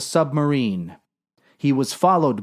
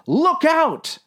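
high-pitched urgent yell; yelled warning. A narrator's reading. Sailor warning the men of attack, high yell. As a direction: cry out a sudden alarm. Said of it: "yelled the man".

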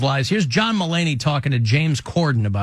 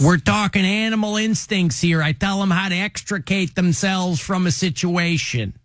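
higher-pitched Chicago cop impression; punchy mock Chicago safety talk; Chicago accent; 4 semitones higher than usual. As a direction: lecture like a Chicago detective. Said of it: "that's the Chicago accent".

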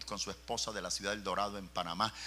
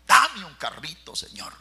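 loud plea; 7 semitones higher, 14 dB louder than usual. Retold or imitated. Pleading prayer to God retold.

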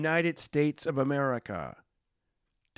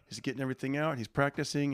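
ordinary talk

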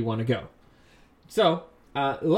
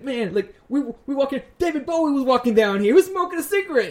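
much higher, louder giddy gushing; imitating excited Eric; 11 semitones higher, 5 dB louder than usual. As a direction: gush like a starstruck fan. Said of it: "I saw Eric be excited".

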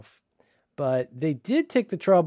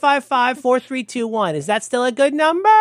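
much higher, louder, chirpy put-on clerk; high, slow mock receptionist; 11 semitones higher, 9 dB louder than usual. Mocking the receptionist reading his other number, high and chirpy. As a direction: read it out like a bubbly clerk.

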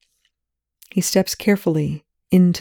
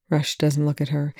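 ordinary delivery

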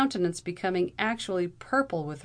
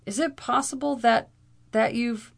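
higher, halting anxious question; gasping; 6 semitones higher, 4 dB louder than usual. A narrator's reading. Frightened man questioning his companion in his ear, gasping. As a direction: stammer it higher, breaking off. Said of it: "gasped Frank Curtis".

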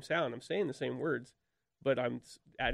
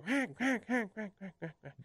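quack cadence; 8 semitones higher than usual. Shown as a demonstration. Explaining cadence to the host, descending imitated quacks. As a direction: imitate duck quacks, high first, falling away. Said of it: "start at a high pitch and you come down".

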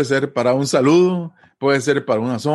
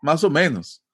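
casual reply; 5 semitones higher, slower than usual. Shown as a demonstration. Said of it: "una respuesta a algo que yo le pregunto".

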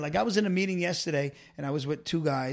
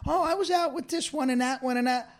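breezy wife; 8 semitones higher than usual. Retold or imitated. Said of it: "You're like".

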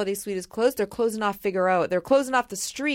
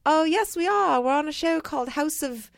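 higher-pitched mock British accent; put-on British accent; 6 semitones higher than usual. Girls telling mom about their show, mock British accent. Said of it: "They all have accents".

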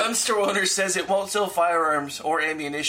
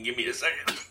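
ordinary delivery

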